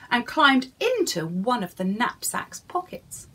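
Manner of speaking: ordinary delivery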